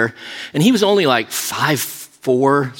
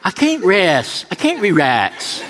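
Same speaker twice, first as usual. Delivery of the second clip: mock pained protest; 5 semitones higher, slower than usual. Retold protest under painful massage.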